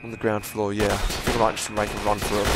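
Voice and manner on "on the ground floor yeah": ordinary delivery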